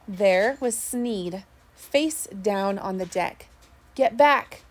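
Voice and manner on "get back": louder, sharp yell of command. A narrator's reading. Mate yells at the fighter to get back, loud yell. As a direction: bark it out to stop the fight. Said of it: "yelled the mate at me".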